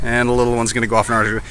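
normal talking voice

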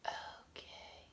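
a breathy, hurt little whisper; deflated whisper. Retold or imitated. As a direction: whisper it, swallowing the sting. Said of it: "And I was just like".

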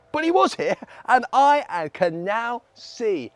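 high, loud excited declaration; joyful testimony. Acted. Healed beggar tells the crowd of Jesus, excitedly. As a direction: proclaim it loudly and high.

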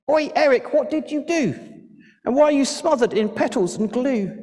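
put-on very high-pitched scolding kid sister; indignant little sister. A narrator's reading. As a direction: confront your brother in a high little-girl voice. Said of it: "It was Eric's little sister".

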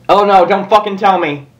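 normal talking voice

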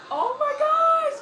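high, slow, laughing near-shout; panicked oh my gosh. Real, not acted. Gamer cries out as Gandalf casts a spell. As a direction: cry it out, half laughing, half scared.

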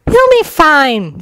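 high, slow brush-off; dismissive, drawn out and high. A narrator's reading. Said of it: "Sakura said dismissively".

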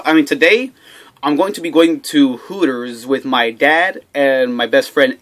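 ordinary talk

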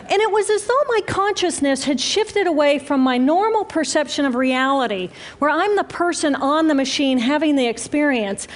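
ordinary talk

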